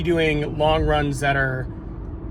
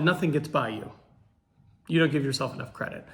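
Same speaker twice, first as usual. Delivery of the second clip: deadpan sarcasm; 5 semitones lower, 8 dB quieter than usual. Retold or imitated. Imagined sarcastic reply to viewers.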